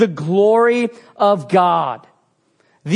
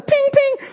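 chain-snap ping; 3 dB louder than usual. Retold or imitated. Mimicking chains snapping.

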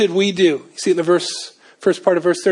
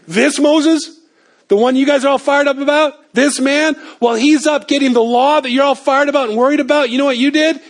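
Stephen confronting them; 8 semitones higher than usual. Acted. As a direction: hammer them like Stephen did. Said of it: "you can almost see Stephen doing it".

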